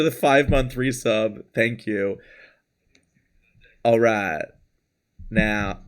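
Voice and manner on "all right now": low, soft cartoon Southern drawl; put-on Mississippi Southern, like a bad McConaughey; Mississippi accent. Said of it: "my authentic Mississippi accent".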